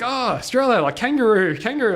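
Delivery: imitating an excited new acquaintance; delighted recognition. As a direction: act out his thrill at placing the country. North Korean man delighted at Australia.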